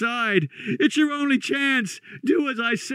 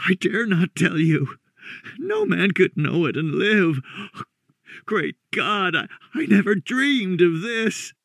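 higher-pitched, haunted, halting dread; fearful hoarse voice tinged with despair; 6 semitones higher than usual. Acted. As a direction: force it out hoarsely between pauses, close to despair. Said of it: "hoarse with fear and now apparently tinged with despair".

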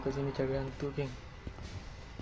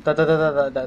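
panicked shout; 14 dB louder than usual. Real, not acted. Panicking at ghost in game.

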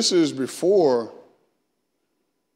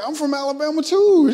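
high squeaky voice, much higher and louder; mock high voice; 8 dB louder than usual. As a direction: put on a high, loud, excited voice.

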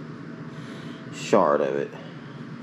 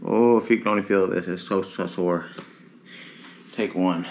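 normal talking voice

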